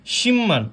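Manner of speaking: শিম্মান run together with a double m; correct pronunciation. Shown as a demonstration. Teacher checking with students, correct assimilated শিম্মান. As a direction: model শিম্মান as "shim-man", without any b. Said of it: "আমাদের উচ্চারণ হবে শিম্মান".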